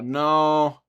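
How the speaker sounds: ordinary talk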